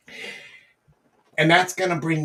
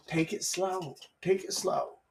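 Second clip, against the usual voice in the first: soft, soothing self-talk; calming down; 9 dB quieter than usual. Shown as a demonstration. Coach calming the jittery approacher, soft. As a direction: settle it right down, softly.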